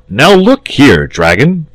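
stern saint calling the dragon to order; firm. A narrator's reading. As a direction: take him to task firmly. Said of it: "said the saint firmly".

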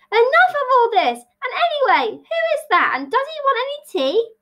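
very high, squeaky dormouse voice; small creature piping up, fed up; exasperated dormouse. Acted. Dormouse interrupts the quarrel, high-pitched and exasperated.

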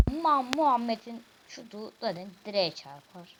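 ordinary delivery

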